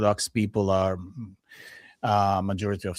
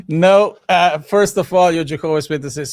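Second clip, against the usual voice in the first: louder, higher mock-emphatic rebuff; retold firm refusal; 9 semitones higher, 11 dB louder than usual. Telling of rebuffing a Jehovah's Witness, emphatic refusal. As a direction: recount turning someone down, loud and emphatic.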